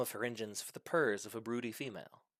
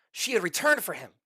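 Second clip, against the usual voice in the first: excited; 6 semitones higher, 8 dB louder than usual. A narrator's reading. Dragon's joy at plane.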